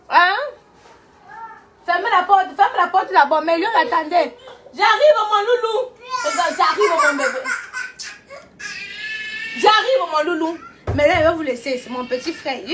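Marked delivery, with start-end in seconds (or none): 4.7-10.6 calling out. Real, not acted. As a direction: call out to the little one to hold on. Calling out to her little brother.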